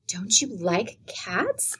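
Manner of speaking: soft, slow, wondering; wonderingly. A narrator's reading. Emily wonderingly asks Aunt Elizabeth, soft and slow. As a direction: ask it in disbelief, softly. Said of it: "asked Emily wonderingly".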